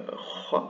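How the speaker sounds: "ra" with a trilled tongue-tip r; rolled "ra"; Spanish rolled r. Shown as a demonstration. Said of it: "du son espagnol".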